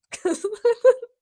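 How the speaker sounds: high, loud burst of laughter; real laugh. Real, not acted. Reader loses it at stupid dialogue, high laughing outburst. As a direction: crack up helplessly.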